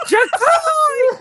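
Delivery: high, loud cry of a name; startled high shout of a name. A narrator's reading. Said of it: "Vixen shouted".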